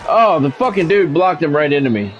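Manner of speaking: ordinary delivery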